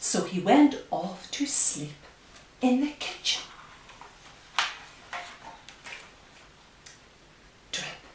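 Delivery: slow, hushed storybook reading; reading to children. Acted. Reads picture book aloud.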